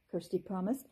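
ordinary delivery, nothing marked

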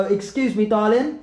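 Essex "Excuse me, darling," with a cockney lilt; Essex accent. Shown as a demonstration. Vlogger demos an Essex line for the auntie, in a light Essex accent. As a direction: coax her with a cheeky Essex greeting. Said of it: "Go, go".

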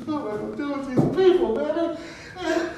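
from hushed whimper up to high wail; mock crying lament. Crying over people who don't understand, mock tearful exasperation. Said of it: "he just cried because".

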